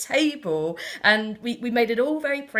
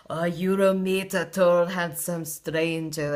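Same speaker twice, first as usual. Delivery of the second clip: hammed-up fake Spanish accent, drawn out slowly; 4 semitones lower, slower than usual. Fortune teller to a child at the fete, in a fake Spanish accent. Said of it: "fake, um, Spanish accent going on".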